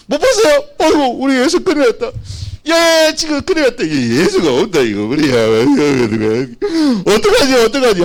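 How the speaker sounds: high, loud, frantic wailing; hammed-up parental panic, pitch leaping up; acted panic. Parents realising their son is missing, acted panic.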